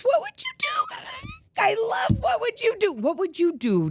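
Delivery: very high shouted show title; excited. Real, not acted. Vlogger excitedly shouting her favorite show's title. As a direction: belt it like a fan.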